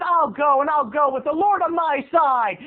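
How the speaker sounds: high, self-righteous retort; hammed-up indignant boy; indignant kid. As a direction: declare it with mock righteous defiance. Retold kid's defiant exit.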